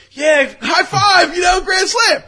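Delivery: high slow shout, cheering; excited shout. Retold or imitated. The boyfriends cheering the grand slam, high slow shout. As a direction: bellow it like a happy fan.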